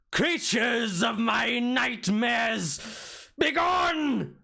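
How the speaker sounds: high, slow, shrieking ghost voice; ghostly shriek. Acted. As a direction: wail it out like a vengeful spirit. Ghost shouts at the intruders.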